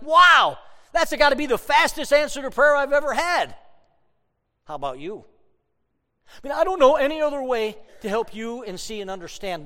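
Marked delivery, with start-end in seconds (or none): 0.0-3.6 a big high, loud burst of amazement; amazed wow. Retold or imitated. Pastor recalling his instant answered prayer, amazed and loud. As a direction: exclaim like you can't believe it.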